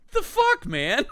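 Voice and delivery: high squealing laugh breaking into words; real laughter. Real, not acted. Laughing at the movie scene, squealing real laugh. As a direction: laugh helplessly through the curse.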